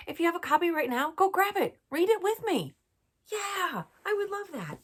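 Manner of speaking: fast, high and loud; excited. Acted. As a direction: get kids thrilled to read along. Urging kids excitedly.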